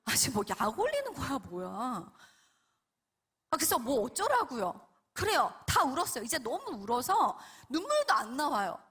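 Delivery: exasperated, swooping acted outburst; acted irritated defiance; defiant back talk. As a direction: talk back like a fed-up child. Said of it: "감히 겁도 없이 막 하나님께 대들었어요".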